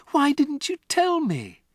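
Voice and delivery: put-on high, loud, hurt child's question; feeling betrayed; shocked girl. Matilda to Miss Honey, high shocked cry. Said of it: "Matilda cried".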